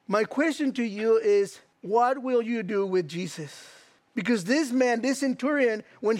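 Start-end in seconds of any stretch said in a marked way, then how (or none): none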